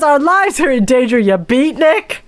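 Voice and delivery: exasperated Mrs Peacock. Retold or imitated. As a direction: play the exasperated lady. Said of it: "and she says".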